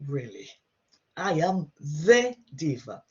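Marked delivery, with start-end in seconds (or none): 1.2-3.0 the word the stressed, said slowly; I am the Diva, leaning on the. Shown as a demonstration. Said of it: "What I'm emphasizing here is the use of".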